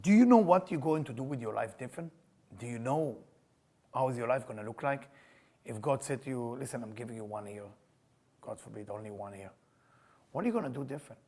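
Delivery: hushed earnest sermon; quiet sincere. Acted. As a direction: lean in and ask gently.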